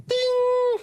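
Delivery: a very high, loud bell ding; ping it out like a microwave bell. Shown as a demonstration. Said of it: "microwave sound imitated".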